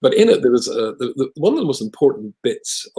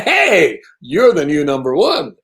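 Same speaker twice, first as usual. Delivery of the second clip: higher, louder mock congratulation; mock cheer; 7 semitones higher, 6 dB louder, much slower than usual. Rabbi and wife crowning the tactless lady, in mock cheer. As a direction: crown the idiot with a grin. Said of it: "we were like".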